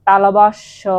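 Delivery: তালবাস্য loud, with the last syllable set apart; তালবাস্য projected as a teaching example; pronunciation demo. Shown as a demonstration. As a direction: sound out তালবাস্য firmly, holding the end. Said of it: "তালবাস্য উচ্চারণ করতে কিন্তু".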